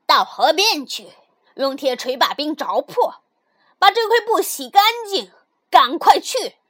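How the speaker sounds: loud, forceful orders; harsh commanding. Acted. Stepmother sending the girl to the frozen river, harshly. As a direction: order someone out with angry urgency.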